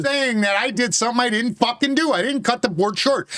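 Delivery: high, heated shouting match voice; real indignant yelling; loud and angry. Real, not acted. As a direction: argue hard, pitched well up. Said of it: "Why are you so loud?".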